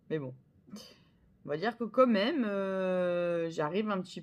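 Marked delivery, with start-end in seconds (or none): 0.1-0.3 breathless laugh. Real, not acted. To viewers about her bad English, whispered laugh. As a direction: laugh it off under your breath.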